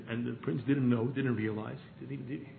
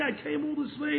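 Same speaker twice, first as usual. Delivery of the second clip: much higher anguished cry; mock crying scream; 13 semitones higher than usual. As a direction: wail it in despair. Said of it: "crying and screaming".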